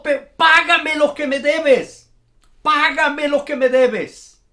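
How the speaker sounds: loud, slow, choking fury; angry demand. A narrator's reading. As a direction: bark the debt at him while throttling him. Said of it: "este hombre estaba enojado". (exigencia furiosa y lenta)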